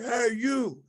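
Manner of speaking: high-pitched mock hail; retold call-out. Sergeant hailing a soldier, high retold call. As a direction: call out as if to someone at a distance. Retold superior calling him out.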